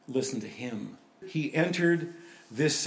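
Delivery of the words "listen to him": softer, lower, Listen said gently; quiet reflection. Shown as a demonstration. Preacher repeating the command to the congregation, quiet and reflective. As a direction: let Listen fall quietly. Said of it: "That's a pretty good vision to have".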